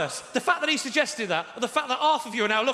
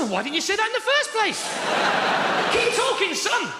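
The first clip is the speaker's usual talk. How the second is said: sarcastic eagerness; 8 semitones higher than usual. Retold or imitated. Sarcastic eager reply to salesman.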